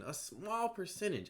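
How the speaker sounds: ordinary delivery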